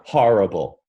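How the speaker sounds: horrible with an open ah like father; New York accent on horrible, open ah; New Yorker saying horrible. Shown as a demonstration. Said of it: "That would be very New York".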